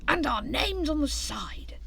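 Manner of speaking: slow, whispered dreamy voice. A narrator's reading. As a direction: drift off in a wish. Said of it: "continued Hare dreamily".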